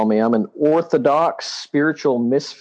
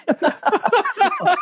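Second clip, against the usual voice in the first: high laugh. Real, not acted. Laughs at own joke.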